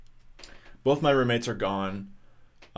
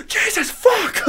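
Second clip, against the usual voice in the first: much higher and louder, far from her own voice; startled scream; 7 dB louder, much slower than usual. Retold or imitated. The mouse trap snaps, startled yelp and laugh. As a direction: jump out of your skin.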